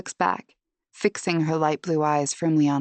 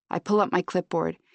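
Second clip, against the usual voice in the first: plain reading voice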